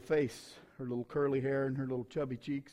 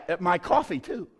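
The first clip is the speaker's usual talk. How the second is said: mock shout; 5 semitones higher, 9 dB louder than usual. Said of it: "you guys go like".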